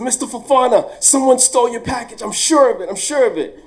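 high, loud, urgent rush of news; excited student. Retold or imitated. Student bursting into the classroom, high excited report. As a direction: blurt it out breathlessly high. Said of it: "She said".

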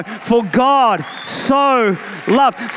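words drawn out slowly and firmly; slow, emphatic. Shown as a demonstration. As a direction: lean into each word. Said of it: "you thought he was judgmental? No, no".

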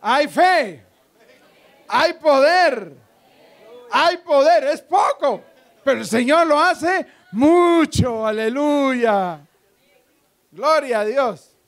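loud, high declarations with drawn-out key words; full-force preaching. Real, not acted. Preacher proclaiming faith and power to the congregation, full-force preaching. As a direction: proclaim it boldly to the whole church.

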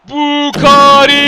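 long, loud yell of the name; exasperated drawn-out shout. Real, not acted. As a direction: call him out in frustration. Goal miss, calls player.

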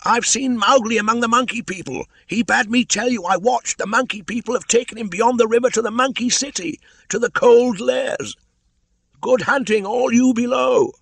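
very high, loud bird cry from above; a shrill-pitched kite shouting down to the bear; Chil the kite calling. Acted. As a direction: call down from the sky like a kite.

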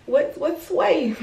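high, slow sing-song tease; drawn-out teasing hello; playful mock greeting. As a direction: stretch it out like a silly joke. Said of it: "I'm just playing".

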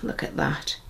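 breathy hushed whisper. Real, not acted. Whispering at the gorgeous box to the viewers. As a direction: hush it like a secret. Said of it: "Don't know why I'm talking like this".

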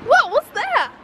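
sudden high-pitched what was that; startled, pitch jumping up; surprised. Real, not acted. Kid asking dad, surprised and high. Startled question after TV interview.

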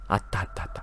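soft unvoiced whisper; whispered find. Real, not acted. Hiding from the monster, whispering the find. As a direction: breathe it out so she won't hear. Quietly finding item.

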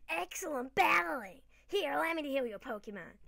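high, hammed-up American accent; Jake, attempted American accent. Jake offering to heal Pokemon after battle, in fake American accent. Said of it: "I do American accent for Jake".

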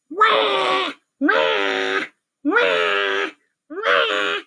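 repeated mock baby cries; baby's wail. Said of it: "started to cry".

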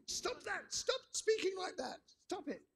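put-on very high, squealed child's protest; furious little girl. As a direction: imitate a small daughter's tantrum in falsetto. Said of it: "my little daughter got furious".

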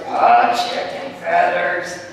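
chicken feathersを低くうなるように、ゆっくり; a mild mock growl, slow. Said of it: "you have to do it growling".

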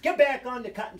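mock exasperated command; fried. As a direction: bark it mildly, as a retold scolding. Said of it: "So he is fried".

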